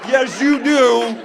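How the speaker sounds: high, loud, jealous accusations as the referee; accusing woman referee. Acted. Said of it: "they actually had a woman referee".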